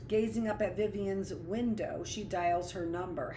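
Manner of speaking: ordinary delivery, nothing marked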